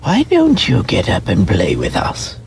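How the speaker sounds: put-on a friendly, inviting young rabbit; wild rabbit voice. A narrator's reading. Wild rabbit asking the toy rabbit to play, lightly voiced.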